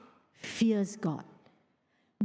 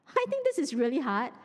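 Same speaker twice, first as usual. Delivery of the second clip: normal talking voice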